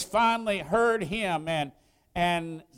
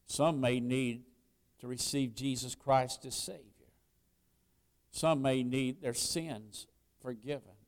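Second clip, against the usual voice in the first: soft, low preacher's voice; hushed pastoral appeal; 8 semitones lower, 8 dB quieter than usual. Real, not acted. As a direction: gently address each need in the congregation. Softened altar appeal to congregation.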